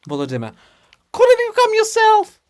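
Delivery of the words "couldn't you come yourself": much higher, loud indignant shout; high loud indignant retort; shouting back. Acted. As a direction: yell it back down, exasperated.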